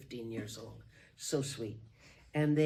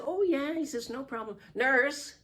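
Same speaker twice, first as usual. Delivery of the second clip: eager call; 10 semitones higher, 6 dB louder than usual. Retold or imitated. Doctor calling out to nurse.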